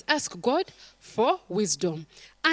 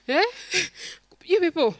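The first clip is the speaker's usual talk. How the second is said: ordinary talk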